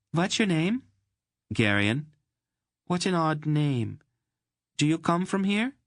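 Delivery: put-on a delighted girl meeting someone exotic; Mady, curious and charmed by the stranger. Narrator voices Mady.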